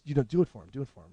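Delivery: hushed urging, fading very soft; quiet, confidential nudge; in the ear. Retold or imitated. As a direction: imitate a discreet prompt in someone's ear. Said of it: "In my ear".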